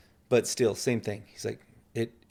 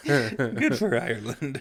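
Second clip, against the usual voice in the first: laughing; slower than usual. Real, not acted.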